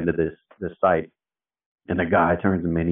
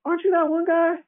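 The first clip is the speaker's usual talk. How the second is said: much higher, excited fan voice; starstruck recognition. Retold or imitated. Older site crew recognizing the famous page guy, high excited fan voice. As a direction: squeal it like you've spotted a celebrity.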